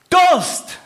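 sudden loud high gasp of thirst; cry of thirst. Retold or imitated. Preacher acting a parched man crying for water, loud and high. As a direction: burst out like a man dying of thirst. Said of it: "So wie ein Verdurstender lechzt".

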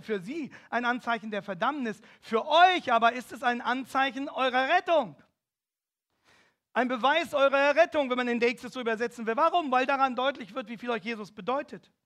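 preacher lifting his voice to a loud climax; preaching at full force. Acted. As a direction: rouse the congregation.

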